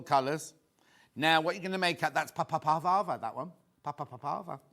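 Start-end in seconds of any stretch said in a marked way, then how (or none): none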